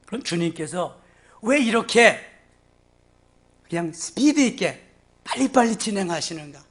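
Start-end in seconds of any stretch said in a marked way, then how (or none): none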